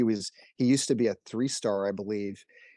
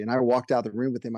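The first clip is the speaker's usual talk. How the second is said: normal talking voice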